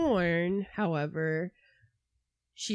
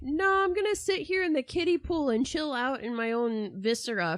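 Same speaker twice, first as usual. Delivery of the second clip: sarcastic mock voice; 7 semitones higher, 3 dB louder than usual. Speaker voicing mother's imagined reply.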